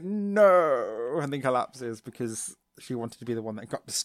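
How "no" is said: loud, higher-pitched melodramatic no; Kari. Acted. Girl denied her chance to be destroyed, loud hammed-up cry. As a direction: cry out a long mock-tragic no. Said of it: "And then Kari's like".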